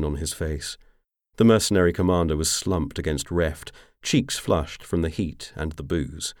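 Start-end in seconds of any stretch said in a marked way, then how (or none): none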